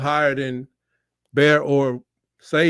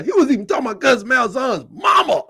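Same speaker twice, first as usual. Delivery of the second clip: teasing shout; 9 semitones higher than usual. Real, not acted. Teasing shout about Malzahn's mama.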